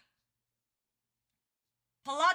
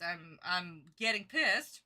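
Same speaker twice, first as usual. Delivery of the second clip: slower, weighted irritation; slow irritated repeat; 9 semitones lower than usual. Real, not acted. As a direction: lean on pissed slowly.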